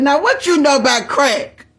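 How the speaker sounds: disbelieving challenge to grandma; teasing grandma, loud and high; incredulous retort. Retold or imitated. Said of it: "I said".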